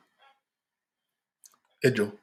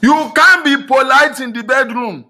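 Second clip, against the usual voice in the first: preaching shout; 10 semitones higher, 9 dB louder, slower than usual. Real, not acted. Preacher driving point home.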